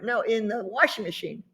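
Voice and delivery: ordinary talk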